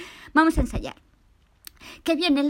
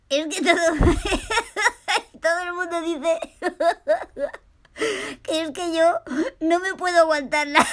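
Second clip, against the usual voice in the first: high giggly voice, words breaking into laughter; laughing; 6 semitones higher, 4 dB louder than usual. Acted. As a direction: talk through a fit of the giggles. Paco no aguanta la risa. (riendo a carcajadas, sin poder parar)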